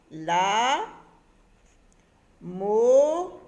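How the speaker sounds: slow, loud, drawn-out syllables with long gaps; syllable-by-syllable dictation. Shown as a demonstration. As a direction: stretch each syllable as if writing it. Dyktuje sobie zapisywane słowo sylabami.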